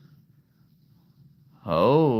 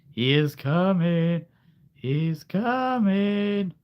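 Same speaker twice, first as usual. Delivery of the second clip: high, slow, eerie voice; slow, creepy, sing-song warning; ominous warning; 8 semitones higher, 4 dB louder, much slower than usual. Acted. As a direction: stretch it out like a spooky prophet. Ominous announcement in story.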